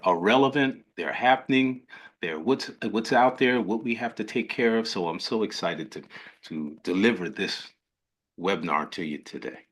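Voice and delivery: ordinary talk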